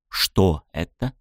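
slow, wary question; suspicious. Acted. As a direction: ask warily, distrusting the food. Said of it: "с подозрением поинтересовался".

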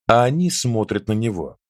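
ordinary delivery, nothing marked

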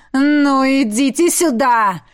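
slow, defiant shout. A narrator's reading. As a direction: call the enemy out, drawing it out. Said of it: "выкрикнул Эммет". (вызывающий протяжный крик)

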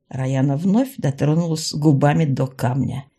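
plain reading voice

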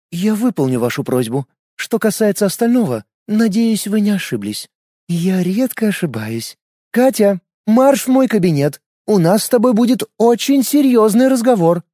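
put-on stern young woman. A narrator's reading. Lena answers, then orders Katya.

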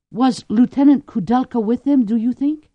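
ordinary delivery, nothing marked

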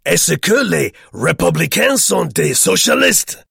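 loud, high, boisterous French; hilarious questioning. A narrator's reading. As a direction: fire off merry questions in French at full voice. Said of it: "interjected Herr Vogel hilariously".

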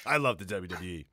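lightly voiced wrestler promo, dropping low; John Cena. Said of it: "I'm John Cena".

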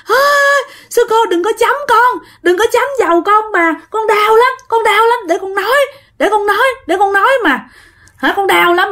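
put-on very high, pleading small-child voice; crying out as child. Possessed woman begging the nun to stop, high childlike cry. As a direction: cry out like a little kid in pain. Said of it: "Thì lúc này dì gào lên".

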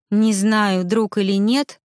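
ordinary delivery

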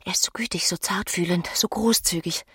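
soft whispered longing; dreamy whisper. Acted. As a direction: whisper his praises to herself. Emma dreams of Rodolphe.

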